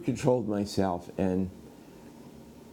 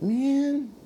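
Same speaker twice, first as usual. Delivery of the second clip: high, strained "man" of exasperation; frustrated outburst. Retold or imitated. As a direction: let out a pent-up, high-pitched yelp. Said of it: "like that".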